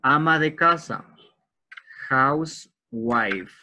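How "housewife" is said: "Housewife" stretched out very slowly; slow model word. Shown as a demonstration. Teacher modelling a new word for the students. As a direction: draw out each sound of "Housewife". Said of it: "teacher drills word pronunciation".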